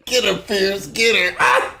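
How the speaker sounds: egging on. Real, not acted.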